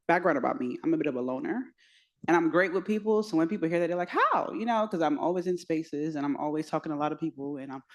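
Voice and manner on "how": squeaky high incredulous How; mock disbelief. Friends hearing she's a loner, squeaky disbelief.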